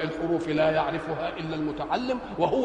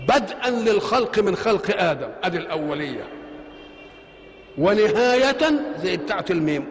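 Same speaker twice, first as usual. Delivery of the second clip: ordinary talk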